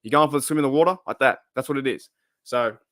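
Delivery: usual speaking voice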